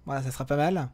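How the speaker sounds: ordinary talk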